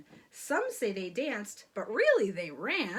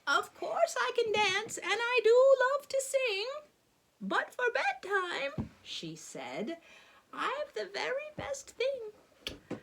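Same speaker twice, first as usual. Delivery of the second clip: put-on old woman voice; 9 semitones higher than usual.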